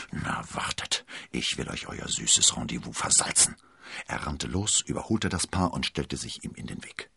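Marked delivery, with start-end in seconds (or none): 0.1-3.6 low, grim private threat. A narrator's reading. Jealous D'Artagnan plotting to spoil the rendezvous, grim. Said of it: "dachte er grimmig".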